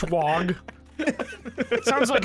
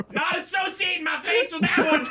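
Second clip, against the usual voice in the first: laughing shout; 7 semitones higher than usual. Real, not acted.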